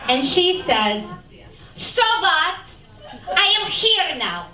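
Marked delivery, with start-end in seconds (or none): 1.7-4.5 high-pitched, hammed-up immigrant mom with an accent; Latvian refugee mother. Refugee mother answers her daughter on immigration, defiant imitated accent. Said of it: "You're a refugee from Latvia".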